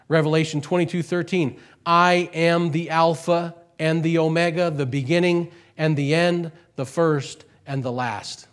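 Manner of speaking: loud, high proclaiming of scripture; preaching at full force. Acted. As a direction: thunder the verse out to the congregation. Loud scripture reading in sermon.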